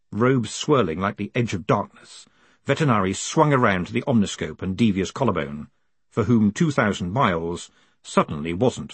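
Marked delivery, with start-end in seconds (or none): none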